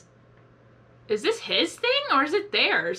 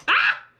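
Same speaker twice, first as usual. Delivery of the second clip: short high loud squeal; sudden high-pitched yelp of excitement; excited shriek; 7 dB louder than usual. Real, not acted. Fan spotting Knuckles' hat, excited shriek.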